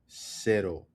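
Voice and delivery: "Cero" as SEH-ro, first syllable held; stress on CE. Shown as a demonstration. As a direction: lean on the CE of Cero. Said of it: "The emphasis is on the C".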